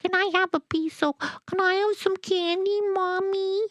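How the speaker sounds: put-on high-pitched little girl voice; put-on babyish girl voice, very high; baby talk. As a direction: beg like a tiny kid, way up high. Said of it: "I'd talk baby talk like the girl down the street".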